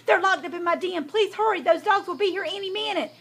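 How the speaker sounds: high, hurried-sounding plea; urgent fox. A narrator's reading. Fox fears dogs will be here any minute, urgent. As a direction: plead anxiously for quick help.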